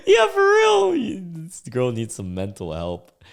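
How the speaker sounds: high squeal of laughter settling down; laughing agreement. Real, not acted. Host agreeing with a comment about the girl, cracking up. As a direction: burst out laughing through the words, then recover.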